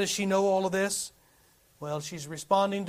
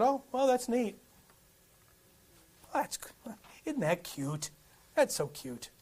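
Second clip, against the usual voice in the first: soft, lightly mocking polite dismissal; patronizing mock praise; 2 semitones higher, 6 dB quieter than usual. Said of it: "That's what the world's like sometimes".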